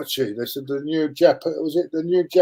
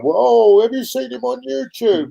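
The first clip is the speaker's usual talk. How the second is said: mock gushing fans; 9 semitones higher than usual. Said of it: "people going on about Javinha".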